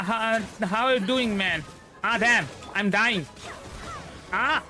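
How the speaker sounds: normal talking voice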